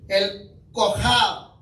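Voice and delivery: ordinary talk, nothing marked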